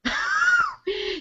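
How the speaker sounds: short high-pitched laugh. Real, not acted. Actress ending her booking story, high laugh. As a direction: laugh at your own story.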